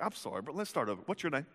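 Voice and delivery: soft, quick conciliatory aside; hushed, mock-sheepish peace offer; calm restart. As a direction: back down quietly after a shouting match. Jesus calmly restarting after shouting.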